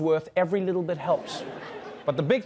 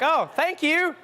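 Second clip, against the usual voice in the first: cheery thanks; 11 semitones higher, 4 dB louder, slower than usual. Retold or imitated. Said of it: "And you're like".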